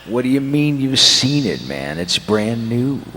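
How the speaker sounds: ordinary talk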